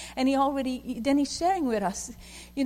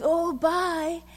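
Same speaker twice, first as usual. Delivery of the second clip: a high, light voice for Mike; lightly voiced friend waving goodbye; quick casual goodbye; 8 semitones higher, 4 dB louder than usual. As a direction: toss off a friendly bye, pitched up. Mike saying goodbye at van.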